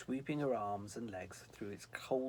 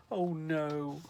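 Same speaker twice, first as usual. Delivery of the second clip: high, louder dismayed angel; 6 semitones higher, 7 dB louder than usual. Acted. Snow angel dismayed in front of Sylvie. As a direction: angel groaning at his mistake.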